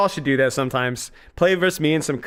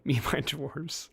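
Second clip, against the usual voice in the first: whisper; 8 dB quieter than usual. Acted. Giggling aside, repeating the joke.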